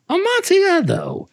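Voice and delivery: very high squeal of a tipsy man; giddy drunk exclaiming. Acted. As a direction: squeak the word in drunken delight. Drunk Fortunato repeating excitedly.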